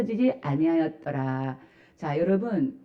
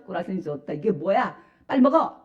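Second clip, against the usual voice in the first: mock angry scolding; 5 dB louder, faster than usual. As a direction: play the fed-up master snapping at the disciples to eat. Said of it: "이러면서 화가 나가지고".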